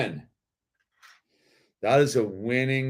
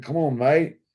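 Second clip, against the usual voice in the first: put-on light English accent, pitched higher; easygoing English accent, raised pitch; 6 semitones higher, 4 dB louder than usual. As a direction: play the toy as a chummy English bloke calming Thor down. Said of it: "Doesn't he have, like, an English accent?".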